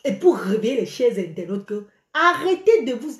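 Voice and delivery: usual speaking voice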